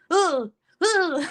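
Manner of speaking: high mock toddler whimpers; two fake sobs of a kid missing mom; imitated crying. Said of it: "you're gonna hear fucking crying at the door".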